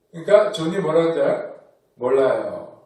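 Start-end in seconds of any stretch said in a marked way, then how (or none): 2.0-2.8 sullen mumble. Retold or imitated. Teen John answering mom.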